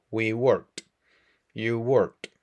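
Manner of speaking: ordinary talk, nothing marked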